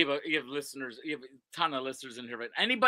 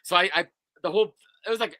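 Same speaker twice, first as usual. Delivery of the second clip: ordinary talk, nothing marked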